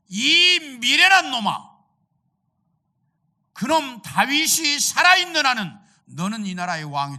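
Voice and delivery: a father roaring at his son, hammed-up; angry king. King Saul scolding his son Jonathan, loud angry rebuke. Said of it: "사울 임금이 화를 내면서".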